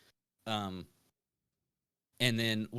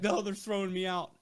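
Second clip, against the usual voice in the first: panicked protest; 9 semitones higher than usual. Retold or imitated. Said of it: "I was like".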